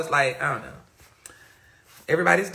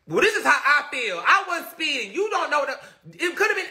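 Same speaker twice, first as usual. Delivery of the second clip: high-pitched imitation of arguing back; mock protesting; 8 semitones higher than usual. Replaying younger self arguing over speeding, high mock protest. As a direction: plead your case, pitched well up.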